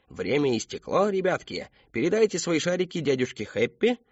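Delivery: high, lilting showman voice; sing-song instructions to little kids; clown entertainer. A narrator's reading. Clown collects the children's balloons, playful showman.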